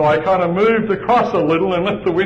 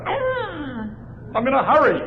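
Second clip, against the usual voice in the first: exasperated cry; 9 semitones higher than usual. Retold or imitated. Frustrated, no parking space.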